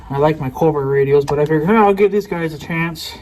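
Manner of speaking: ordinary talk, nothing marked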